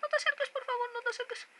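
high desperate begging; panicking gamer. Real, not acted. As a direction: beg it to stay away, frantic. Begging monster not to approach. (ruego desesperado)

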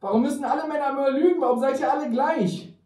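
high, fast, loud wail; tearful outburst. A narrator's reading. Emma bewails that all men lie, tearful outburst. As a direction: wail it out in acted despair. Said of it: "Sie fing an zu weinen".

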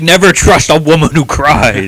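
put-on high, loud, slow scolding girl voice; a shrill-free hammed-up Lucy, pitched high and loud; mock Lucy. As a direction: ham up a bossy little girl lecturing. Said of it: "And she's like".

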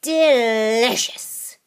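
slow, drawn-out relish; savoring old witch. Acted. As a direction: savor it like a greedy hag. Grandma savoring again.

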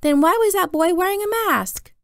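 put-on high, loud puzzled toddler; little brother Jamie. A narrator's reading. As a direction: ask it high and insistent, like a small child. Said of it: "What is it, Jamie?".

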